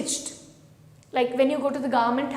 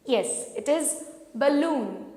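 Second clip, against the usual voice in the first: ordinary delivery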